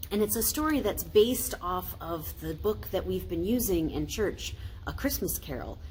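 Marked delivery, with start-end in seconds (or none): none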